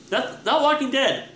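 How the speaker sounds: ordinary talk, nothing marked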